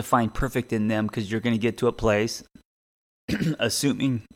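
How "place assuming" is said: choking, clearing the throat; words caught by a spice-choke; choking on a drink. Real, not acted. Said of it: "sorry, I'm choking up".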